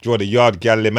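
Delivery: normal talking voice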